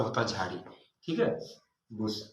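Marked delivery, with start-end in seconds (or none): 1.9-2.2 बुश said softly as bush, with a short u and sh; model pronunciation. Shown as a demonstration. Teacher modelling how बुश sounds for pupils. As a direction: say बुश gently, short u, ending on sh. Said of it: "इसका उच्चारण ऐसा होगा".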